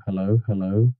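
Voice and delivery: usual speaking voice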